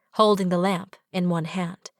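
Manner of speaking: plain reading voice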